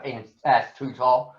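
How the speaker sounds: ordinary delivery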